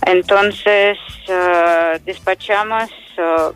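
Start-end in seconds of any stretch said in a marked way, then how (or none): none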